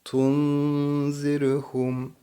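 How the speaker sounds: Tunzirhum, n held in the nose, very slow; nasal nun. Shown as a demonstration. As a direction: hum the n of Tunzirhum. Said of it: "genzimizde tutuyoruz".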